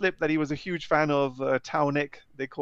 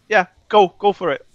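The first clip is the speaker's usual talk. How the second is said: higher, much louder retold approval; encouraging go-ahead; 4 semitones higher, 9 dB louder than usual. Wife letting husband go to the event, encouraging. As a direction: mimic a wife cheering him on. Wife readily gives permission.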